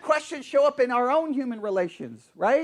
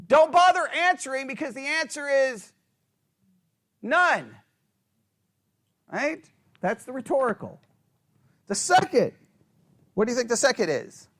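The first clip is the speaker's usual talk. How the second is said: ordinary talk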